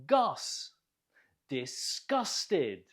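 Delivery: GUS alone, then Disgusted with GUS stressed; stress on second syllable. Shown as a demonstration. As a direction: isolate Gus, then punch it inside Disgusted. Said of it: "Note the stress on the second syllable".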